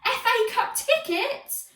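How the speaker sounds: slow, high gasp of wonder; stunned amazement. A narrator's reading. Henry learns of the tickets, stunned. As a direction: say it in thrilled disbelief.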